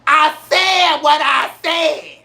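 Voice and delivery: sassy reality star shutting it down; slow and final, daring them to argue; Nene Leakes impression. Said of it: "I'll go Nene Leakes on them".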